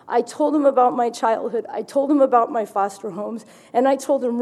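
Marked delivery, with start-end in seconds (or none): none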